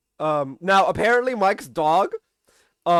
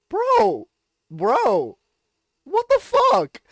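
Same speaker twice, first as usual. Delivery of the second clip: much higher, louder, outraged disbelief; shocked outburst; 6 dB louder, much slower than usual. Retold or imitated. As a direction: yelp it in stunned outrage at the villain. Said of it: "I'm just like".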